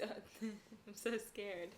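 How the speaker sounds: mock-scared through laughter; sarcastic laughing. Real, not acted. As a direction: laugh out a fake fright. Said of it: "laugh cry".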